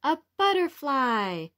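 a proud storytime reveal; excited reveal. A narrator's reading. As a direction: present the answer with delight. Reveals answer to children.

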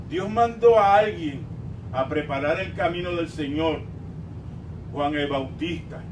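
ordinary talk

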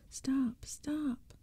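high-pitched cries for help; high, panicked plea without shouting; frightened plea. A narrator's reading. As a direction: cry out while pinned down. Narrator pinned by attacking creature.

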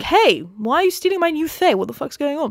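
high-pitched mock indignation; mock outraged protest. Villains protest sisters being taken, high mock outrage. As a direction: start high in hammed-up outrage.

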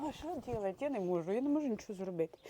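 very soft, broken by tears; quiet despairing sob; helpless crying. Real, not acted. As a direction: whisper it as if giving up. Helpless sobbing.

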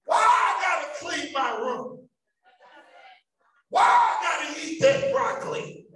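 put-on loud, high whining kid, rising each time; whiny child. Child protesting to parents, loud whining. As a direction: complain like a fed-up child. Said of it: "How many ever heard".

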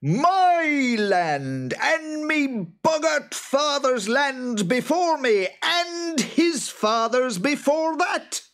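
high, loud, drawn-out creature shriek; indignant monster at the top of his voice; enraged boggart. A narrator's reading. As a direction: screech each claim slowly and furiously. Enraged boggart argues over land.

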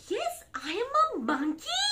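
high monkey voice swinging upward; monkey character. Acted. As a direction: own up as a silly monkey. Book insists reader is monkey.

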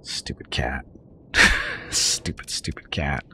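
soft whispered stupid cat; whispered grumble. Real, not acted. Grumbling at the hungry cat, a whispered mutter. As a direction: hiss it under your breath, half amused.

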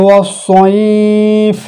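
slow drawn-out chanted recitation; Quran recitation. Shown as a demonstration. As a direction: recite it slowly, stretching the vowels. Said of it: "এই তিনটে বর্ণ উচ্চারণ করার টাইমে". (ধীর টেনে টেনে কুরআন তিলাওয়াত)

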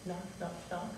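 very soft, delicate knocking words; tiny hushed taps; gentle knock. Acted. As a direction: imitate a gentle knock, barely audible. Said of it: "gentle knock on the door".

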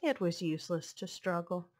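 ordinary delivery, nothing marked

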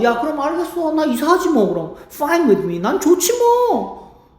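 huffy mock agreement. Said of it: "acted sulky agreement".

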